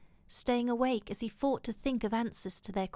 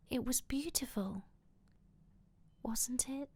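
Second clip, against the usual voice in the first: soft, half-whispered woman's voice; tender appeal; 3 semitones higher, 7 dB quieter than usual. A narrator's reading. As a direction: ask gently, very softly. Woman gently pleads after his panic.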